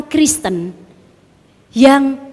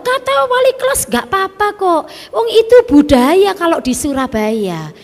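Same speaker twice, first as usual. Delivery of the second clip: high, louder schoolchild voice; child's friend; 8 semitones higher, 4 dB louder than usual. Retold or imitated. Daughter quoting a classmate, high and brash. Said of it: "Tapi temanku jawab".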